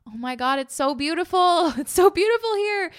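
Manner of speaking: acted joy building to a high, loud laugh; rapturous wonder. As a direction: gush with mounting delight. Acting out joy at being alive.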